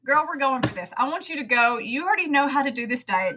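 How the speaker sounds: high, pumped-up encouragement; rally her like a coach; cheering on. Retold or imitated. Cheering the patient on.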